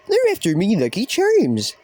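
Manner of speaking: high, slow, hammed-up Irish leprechaun accent; a deliberately bad Irish leprechaun voice, stretched out slow; terrible Irish leprechaun accent. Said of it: "my attempt at a very terrible, uh, Irish, uh, leprechaun accent".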